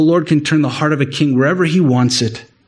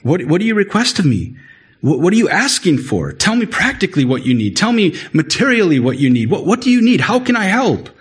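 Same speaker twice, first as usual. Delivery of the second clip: the king pressing to help, building in excitement; eager king; 5 semitones higher than usual. Retold or imitated. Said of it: "the king just offers help".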